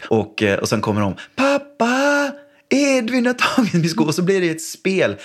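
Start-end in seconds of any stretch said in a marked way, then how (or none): 1.4-4.0 put-on high, slow whining kid voice with a laugh; complaining child. Son reporting his sister to dad, high whining child voice. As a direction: whine to dad through a giggle.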